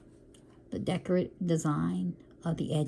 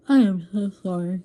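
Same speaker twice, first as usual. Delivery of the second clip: talking through a yawn; 2 semitones higher, 8 dB louder than usual. Real, not acted. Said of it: "Yawning, you guys".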